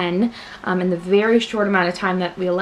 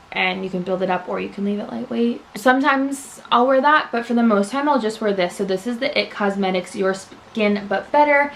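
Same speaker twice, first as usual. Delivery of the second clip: normal talking voice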